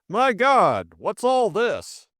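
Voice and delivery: high, loud shock, then drawn out; astonished exclamation. A narrator's reading. Sir Stanley arriving at the scene, astonished. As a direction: burst out aghast.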